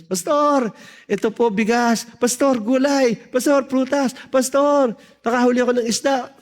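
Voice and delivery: mataas at masiglang pagtawag sa pastor; mga miyembro. Retold or imitated. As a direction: gayahin ang sunud-sunod na tawag ng mga miyembro. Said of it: "Nagpupuntahan na yung mga members". (mataas na boses ng mga naghahandog)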